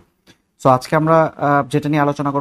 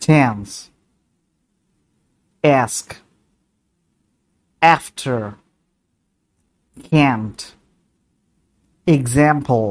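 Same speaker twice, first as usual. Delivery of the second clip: American accent, ক্লাস with a flat a as in cat; American English, short flat a vowels; American pronunciation. Shown as a demonstration. Teacher demonstrates American vowels to the class. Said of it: "আবারও বলছি".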